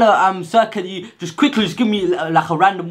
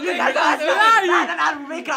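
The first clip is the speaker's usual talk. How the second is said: excited shout; 9 semitones higher, much slower than usual. Real, not acted. Rated nine and a half.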